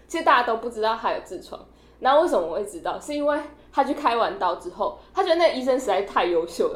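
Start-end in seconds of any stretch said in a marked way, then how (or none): none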